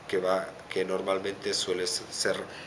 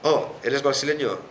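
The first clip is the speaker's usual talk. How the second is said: higher and louder, overly friendly question; mock well-meaning stranger; 6 semitones higher, 6 dB louder than usual. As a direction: play the eager stranger guessing your nationality.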